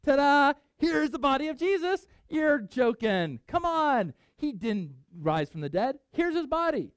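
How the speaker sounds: high, loud, hammed-up taunting; triumphant sneering showman, played for laughs; mocking taunt. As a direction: wave the body at them like a gloating magician. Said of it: "the Sadducees are supposed to say".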